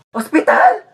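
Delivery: high, rising yelp of alarm; startled question. Acted. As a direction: blurt it out, forgetting to sound sick. Faker startled by hospital threat.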